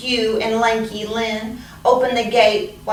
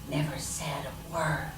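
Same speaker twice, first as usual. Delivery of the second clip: hushed; 4 semitones lower, 12 dB quieter than usual. A narrator's reading. Quiet line about silent ladybug.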